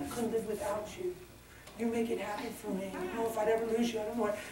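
whisper-soft mock of a desperate partner; a hint of a desperate sweetheart; needy lover. Mocking a needy lover again.

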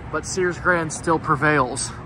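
normal talking voice

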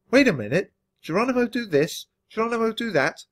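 high, indignant mock quote; indignant protest. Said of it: "held up my paw in protest".

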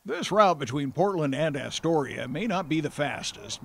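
ordinary talk

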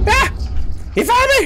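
high panicked yell; startled scream. Real, not acted. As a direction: scream in sudden fright. Chased in a horror game.

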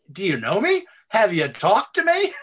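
mock incredulous retort. Retorting to people who want him in politics, mock incredulous. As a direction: toss it back at them, incredulous.

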